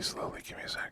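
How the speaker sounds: hushed aside; whispering. Real, not acted. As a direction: whisper it under breath. Aside while opening drink quietly.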